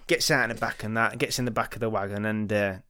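usual speaking voice

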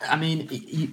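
normal talking voice

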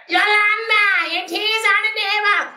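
shrill Bajan mum impression; shrill mother calling. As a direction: call a child to tea, piercingly. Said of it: "but, like, ring shrill".

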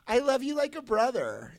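high mock girl voice letting him down; girl's rejection. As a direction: lightly mimic a sweet girl friend-zoning him. Girl's rejection line, voiced mockingly.

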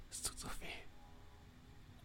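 breathy, voiceless whisper of pain. Acted. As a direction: barely breathe the words out. Crying man whispers.

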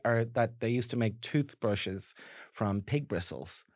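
usual speaking voice

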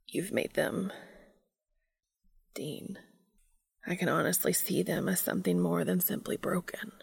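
tender hushed whisper; gentle whisper. A narrator's reading. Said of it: "he says gently".

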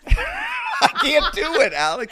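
high, loud laughing protest; laughing surrender. Real, not acted. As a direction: give up helplessly through laughter. Gives up on impression, laughing.

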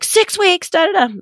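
high-pitched mock accusation; retold blaming. Mother imitating her son's blame over six weeks, mock accusing. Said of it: "a few blames in there".

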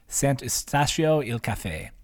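slow, careful Italian accent. Shown as a demonstration. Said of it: "My Italian accent's pretty bad".

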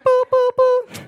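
very high beeping noise; metal detector beeps. Retold or imitated. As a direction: mimic the airport detector.

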